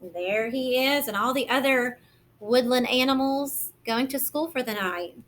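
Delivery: ordinary talk, nothing marked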